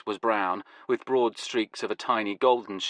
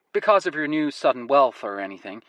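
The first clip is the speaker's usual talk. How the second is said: American woman; 4 semitones higher, 3 dB louder than usual. Acted. Woman character voice.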